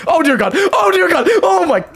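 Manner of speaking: very high, loud panicked wail; real panic as enemies swarm; terrified shriek. Real, not acted. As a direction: shriek as the mobs close in. Being attacked by piglins.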